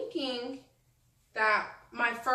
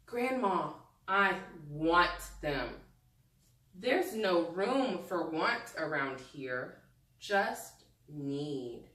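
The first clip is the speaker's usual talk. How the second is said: lower, slower read-aloud voice; story reading; 5 semitones lower, slower than usual. Acted. Teacher reading grandma's words to the class, slow storytelling. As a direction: read the grandma's line gently to young listeners.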